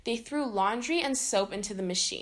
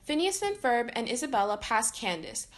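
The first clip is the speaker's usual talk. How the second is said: plain reading voice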